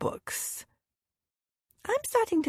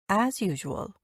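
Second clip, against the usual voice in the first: ordinary delivery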